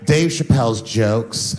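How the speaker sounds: low, slow, indignant blogger voice; made-up blogger voice. Retold or imitated. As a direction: drop low and drag it out pompously. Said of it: "I'm just making this voice up".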